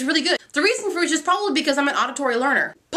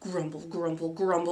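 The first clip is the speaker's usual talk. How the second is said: low grumble trailing off softer; mock grumbling; 8 semitones lower, 9 dB quieter than usual. Teacher hearing about Saturday school, mock grumbling. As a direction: sink into a comic low grumble. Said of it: "I was kind of all grumble".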